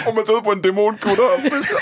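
high voice breaking into laughter; laughing. Real, not acted. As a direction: get it out while cracking up. Cracking up at fishing in hell.

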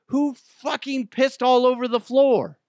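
high, loud outraged demand. Retold or imitated. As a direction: exclaim it in indignant disbelief. Acted outraged reaction in restroom.

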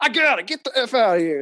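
high, loud mock rebound holler; Carmelo Anthony. Retelling a clip of a lone rebounder, loud high imitation. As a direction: yell it like a player claiming the ball. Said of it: "He's like".

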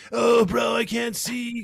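high-pitched mocking surfer-dude voice; Spicoli. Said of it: "started giving him the Spicoli voice".

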